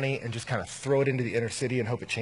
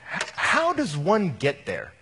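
slow, raised-pitch puzzlement; acted bewilderment, slow and high; baffled curiosity; 4 semitones higher, 4 dB louder, slower than usual. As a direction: draw the question out in exaggerated wonder. Acted baffled question to student.

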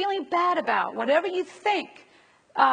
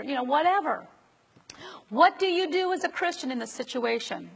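ordinary talk

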